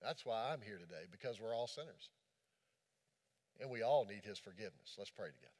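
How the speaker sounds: ordinary delivery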